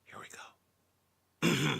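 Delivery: ordinary delivery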